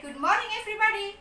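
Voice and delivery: high, fast Good morning everybody; bright teacher greeting. Acted. Teacher greets students in a dialogue reading. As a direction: greet the class cheerfully. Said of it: "The teacher said".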